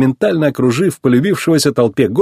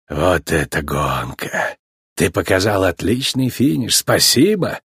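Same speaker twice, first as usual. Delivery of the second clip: soft, low, slow praise; lowered, unhurried appreciative voice; grateful team owner; 4 semitones lower, 4 dB quieter, slower than usual. A narrator's reading. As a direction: thank your champion quietly. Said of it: "поблагодарил Тех, владелец Динока".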